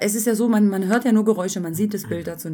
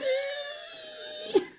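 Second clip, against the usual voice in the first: high squeal; 10 dB quieter than usual. Retold or imitated. Imitating the squeaky sliding sound.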